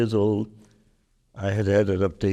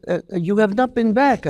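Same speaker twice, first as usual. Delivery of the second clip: imitating a surprised Belgian lady, high-pitched; lightly put-on French accent, pitched up in astonishment; 10 semitones higher, 3 dB louder than usual. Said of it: "who has an accent".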